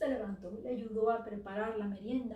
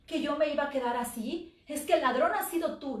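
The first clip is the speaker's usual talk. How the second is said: loud, furious accusation; raging at him, much louder; with great rage; 4 semitones higher, 6 dB louder than usual. Acted. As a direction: throw the blame in his face. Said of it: "pero con muchísimo coraje".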